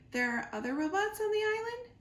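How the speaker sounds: high, quick, curious gosling; young gosling voice. Acted. Gosling asks his robot mother about robots, high and eager. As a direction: ask it like an eager young bird. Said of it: "said the Gosling".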